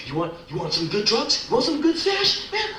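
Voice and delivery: frantic plea to God ending in a shout; panicked pleading building to a shout. Retold or imitated. Prayer escalates to panic.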